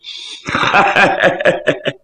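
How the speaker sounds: acted breathy run of ha-ha laughter; scoffing laugh. Younger chief answering elder brother's warning, scoffing laugh.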